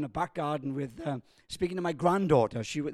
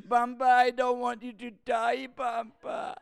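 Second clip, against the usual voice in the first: put-on higher, childlike pleading voice; little granddaughter; 6 semitones higher than usual. Five-year-old begs her grandfather not to die, higher childlike plea.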